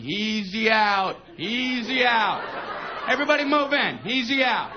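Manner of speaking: slow, drawn-out taunting chant; mock call of fielders jeering the batter; kids yelling. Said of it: "they're all out there yelling".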